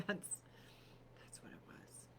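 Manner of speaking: slow whispered aside. Real, not acted. To her viewers, a slow whisper. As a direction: breathe it out as a hushed aside.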